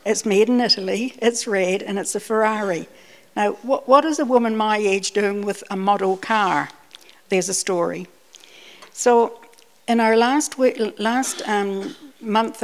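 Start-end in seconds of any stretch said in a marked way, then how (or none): none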